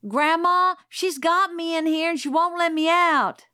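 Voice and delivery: high, loud tattling yell to Grandma; high whiny shout to get Scout in trouble; child's yell. A narrator's reading. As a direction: holler for Grandma like a wronged kid. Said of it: "Francis came to the door and yelled".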